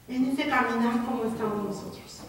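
ordinary talk

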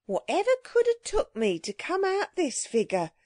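high-pitched, scandalised market woman; marrow-selling woman. A narrator's reading. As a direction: wail like a flustered Cockney street seller. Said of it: "said the marrow-selling woman".

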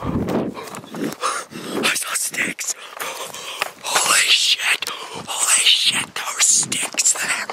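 slow, crying whispered hysterics; whispered panic. Acted. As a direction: sob it out in a hushed panic. Panicked in woods.

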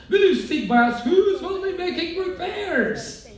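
high, small pleading voice; mayor's cry. A narrator's reading. Mayor asking Horton to stick by them, pleading. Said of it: "cried a voice of the mayor's".

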